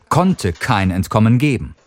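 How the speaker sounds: ordinary delivery, nothing marked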